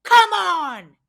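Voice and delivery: high, loud, furious yell at the ref; angry coach screaming at a young ref. Retold or imitated. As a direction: bellow it at the referee in outrage. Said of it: "I yell at him".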